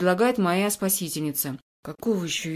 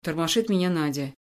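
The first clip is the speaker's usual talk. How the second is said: plain reading voice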